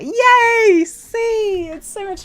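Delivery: high, loud excited squeal; delighted cheer. Real, not acted. Streamer cheering a kind chat message, delighted cheer. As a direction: cheer it with real glee.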